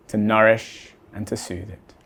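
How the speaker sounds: ordinary delivery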